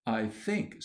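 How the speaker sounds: ordinary delivery, nothing marked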